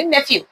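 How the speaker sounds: "nephew" with ph as f; f-sound pronunciation. Shown as a demonstration. Teacher reads out the spelled word, "nephew" with an f sound. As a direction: say "nephew" as NEF-yu. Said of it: "nephew नहीं".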